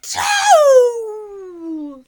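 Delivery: loud high hammed-up achoo; huge sneeze. Wolf sneezing the house down, loud and high. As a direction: blast out a giant sneeze.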